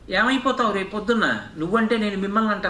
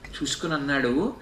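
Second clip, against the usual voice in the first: normal talking voice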